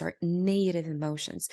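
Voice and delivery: ordinary delivery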